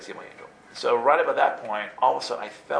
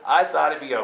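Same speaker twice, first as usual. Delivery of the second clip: ordinary talk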